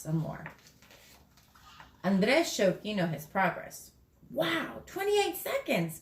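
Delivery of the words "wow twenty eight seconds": higher, impressed exclamation; a friend amazed by a fast time; amazed exclaim. Acted. Gino amazed at Andres' time, high and impressed. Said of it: "Gino exclaimed".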